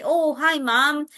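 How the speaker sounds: friendly demonstration hello; modeled greeting. Acted. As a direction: show a child how to greet.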